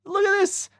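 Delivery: high, loud showing off; happy gamer. Acted. Josh showing friends his silly move, happy and loud. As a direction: call friends over in a high, excited voice. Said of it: "he's pretty happy about it".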